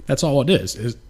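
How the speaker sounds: talking through a burp; words pushed out on a burp. Real, not acted. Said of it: "excuse me, I'm burping and shit".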